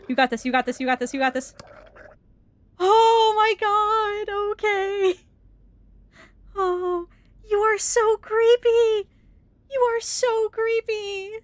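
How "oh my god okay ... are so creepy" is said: much higher, slow and louder; frightened wail. Real, not acted. Facing the creepy monster, a high scared wail. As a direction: wail in fear.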